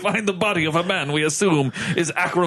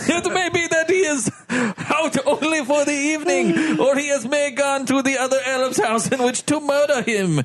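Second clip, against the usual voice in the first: put-on Russian accent; 11 semitones higher than usual. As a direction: shout it as a hammy foreign servant. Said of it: "Russian now".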